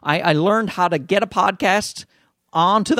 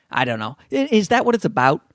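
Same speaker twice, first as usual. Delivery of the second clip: usual speaking voice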